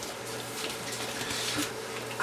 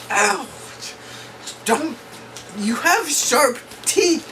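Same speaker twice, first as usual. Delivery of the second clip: ordinary talk, nothing marked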